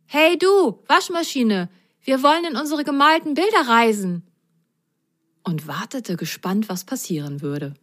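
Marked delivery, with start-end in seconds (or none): 0.1-4.2 put-on higher, fast, eager boy; Grasgrün calling in. Grasgrün speaks into the washing machine, fast and higher. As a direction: call into the drum quickly. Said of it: "sprach einfach in die Trommel".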